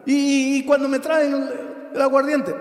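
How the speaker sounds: high, urgent preacher's plea; impassioned preaching. Acted. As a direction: build into a fervent, high-pitched prayer to God. Preaches the model prayer at full force.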